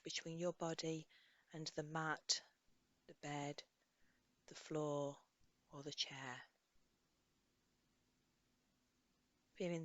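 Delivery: soft, unhurried guidance with long pauses; guided meditation. Acted. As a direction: gently lead a listener through a body scan. Leads a body scan meditation.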